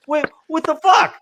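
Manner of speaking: high, outraged double take; mock disbelief. Retelling his shock at the sold-out notice, high mock outrage. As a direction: sell the shock of losing it.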